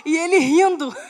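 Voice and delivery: usual speaking voice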